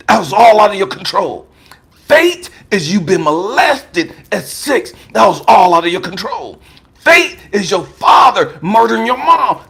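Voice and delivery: preaching with mounting force; building preaching. Shown as a demonstration. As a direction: build the refrain toward a shout.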